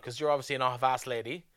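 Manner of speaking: normal talking voice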